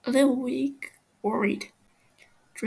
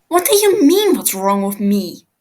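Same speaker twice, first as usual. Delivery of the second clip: angry snap; 12 dB louder than usual. Acted. Tommy snaps at the captive Fofo, loud and angry. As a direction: snap back hard, offended. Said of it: "Tommy snaps at him".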